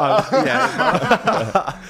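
high, loud laugh. Real, not acted. Laughs at the Italian question.

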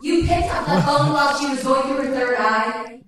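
high-pitched astonished question; incredulous. Real, not acted. Host learns the guests took a call mid-session, incredulous. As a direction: blurt it out in amused disbelief. Host reacts to phone-call revelation, mock outrage.

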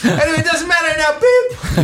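hammed-up shrill confession; squealing confession. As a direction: howl it out through laughter. Mock confession skit.

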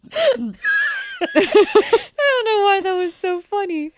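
high, slow, squeezed out through laughter; real laughing fit. Real, not acted. Friend wondering why the joke was so funny, helpless laughter. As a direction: barely recover from laughing.

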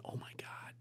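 soft whispered inner groan. Retold or imitated. In his head while listening, whispered dismay. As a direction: breathe it out under your breath. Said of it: "in my own head going".

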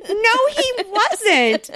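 high, loud, stretched-out denial; mock protest. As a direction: imitate incredulous bystanders, very slow and high. Retold bystanders contradicting the complainer.

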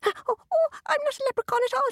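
high squeaky leprechaun voice; tiny fairy man, high and fast. A narrator's reading. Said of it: "said the little man".

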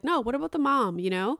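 normal talking voice

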